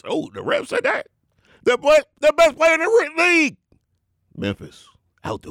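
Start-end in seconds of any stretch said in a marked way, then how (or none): 0.1-3.6 very high, hyped pulpit cry; carried-away preacher. Acted. The Rev crows over his bold pick, very high hype.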